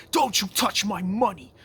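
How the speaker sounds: high, loud mock TV villain shout, guarding his cash; hammed-up furious yell over the money; imitating an angry yell. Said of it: "That episode where he's like".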